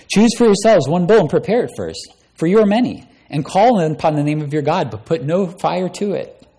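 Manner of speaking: a prophet daring his rivals; challenging prophet. Acted. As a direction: throw down the gauntlet. Elijah challenges the prophets of Baal.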